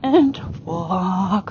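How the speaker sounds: WALK long and dropped; soothing horse cue, WALK stretched low; downward transition command. Shown as a demonstration. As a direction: draw out "walk" with the voice down. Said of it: "my voice goes down".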